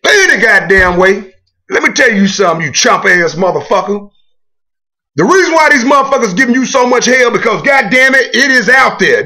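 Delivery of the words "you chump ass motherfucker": ordinary delivery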